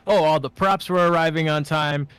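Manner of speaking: normal talking voice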